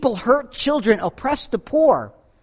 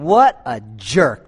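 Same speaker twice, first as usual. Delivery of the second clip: low, slow muttered judgement; What a jerk thought; 9 semitones lower, slower than usual. Retold or imitated. Driver thinking about a reckless speeder, low muttered scorn. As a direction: grumble it under your breath. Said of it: "You'd be thinking".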